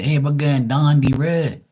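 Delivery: high, loud Bermudian demonstration; Bermudian accent. Shown as a demonstration. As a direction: show off the broad Bermudian way of talking. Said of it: "We say".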